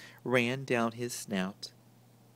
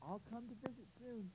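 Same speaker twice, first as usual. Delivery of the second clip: promised; 10 semitones higher, 19 dB quieter than usual. A narrator's reading. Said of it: "promised Alice Jane".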